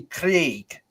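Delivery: "Craig" with a rolling Scottish R; rolled R. Retold or imitated. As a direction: imitate Scots saying "Craig", trilling the R. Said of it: "it's got the rolling of the R".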